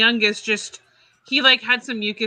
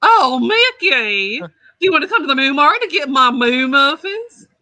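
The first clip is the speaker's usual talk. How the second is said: Clarabelle Cow voice; 4 semitones higher, 5 dB louder than usual. Acted. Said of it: "do Clarabelle".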